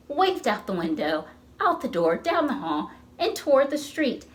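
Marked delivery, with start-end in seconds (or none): none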